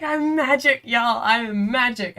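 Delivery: playful self-proclaimed magic; gloating. Retold or imitated. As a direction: crow about your magic. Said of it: "And I was like".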